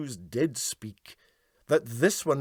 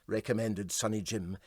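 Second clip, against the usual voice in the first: plain reading voice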